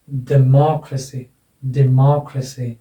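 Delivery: slow "democracy" as de-MOK-ruh-see, falling; stress on MO. Shown as a demonstration. Teacher models the word for students, stress on MO. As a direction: draw out and punch "मो" in "democracy". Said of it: "इसका उच्चारण हो जाएगा".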